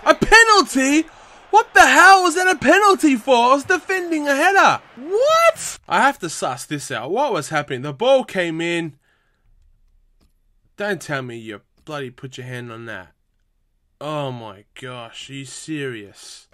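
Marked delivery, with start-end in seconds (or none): 0.0-5.8 high, fast indignant protest; can't believe the referee's call; outraged disbelief. Real, not acted. Penalty given against him. 10.8-16.5 deflated disbelief. Real, not acted. Penalty replay, resigned.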